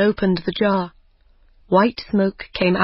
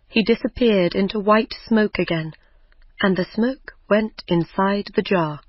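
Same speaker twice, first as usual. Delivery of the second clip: usual speaking voice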